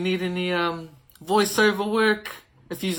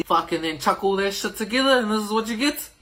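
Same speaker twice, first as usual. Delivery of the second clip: ordinary talk, nothing marked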